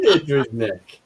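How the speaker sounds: laughing. Real, not acted. Repeating the joke name.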